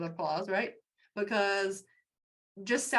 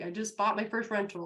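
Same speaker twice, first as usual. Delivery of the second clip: ordinary talk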